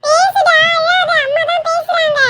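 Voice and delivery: put-on very high, drawn-out kid voice; high, slow childish urging; coaxing child. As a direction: urge a crying playmate in a squeaky voice. Child urges crying friend to talk.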